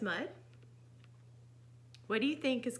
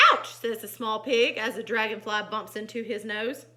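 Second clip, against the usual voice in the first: animated, loud exclamations between narration; lively storytime voice; story narration; 9 dB louder than usual. A narrator's reading. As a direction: read to children with dramatic emphasis. Reads picture book aloud.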